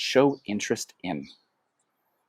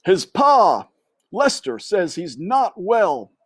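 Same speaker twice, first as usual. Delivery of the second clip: high, louder gossiping uncle mouse; Uncle Alfred; 10 semitones higher, 4 dB louder than usual. A narrator's reading. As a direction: pipe up like a nosy old uncle. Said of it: "said Uncle Alfred".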